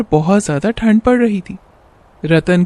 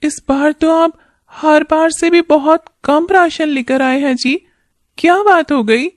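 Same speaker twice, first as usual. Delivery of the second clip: put-on higher, worried young wife; wife's voice; 8 semitones higher than usual. Wife worried about husband's early work, higher wife voice. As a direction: speak as a caring wife fretting over her husband. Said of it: "तब सुधा ने कहा".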